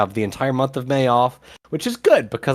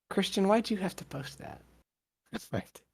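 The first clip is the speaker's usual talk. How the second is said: whispering; 7 semitones higher, 11 dB quieter than usual. Real, not acted. Aside while reading rules.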